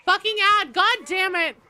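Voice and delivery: loud, high angry yell; furious outburst. Real, not acted. Gamer raging at an ad mid match, yelling. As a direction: blow up at the ad.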